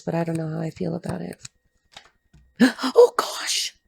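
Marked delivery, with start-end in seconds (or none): 2.6-3.8 gasping, breathy high "Oh, gosh!"; shocked gasp. Real, not acted. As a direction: caught off guard, a sharp gasp. Sudden shocked reaction to a card.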